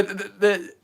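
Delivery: usual speaking voice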